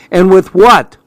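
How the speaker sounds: loud, high, scornful question; sharp challenge. A narrator's reading. Mom doubts dad can pay the bills, challenging. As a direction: throw the question back hard.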